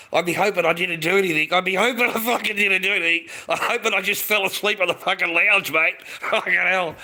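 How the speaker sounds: loud, high, worked-up rant; mock panic. Man imagining his horrified morning after, loud mock panic. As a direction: freak out at full volume, half joking.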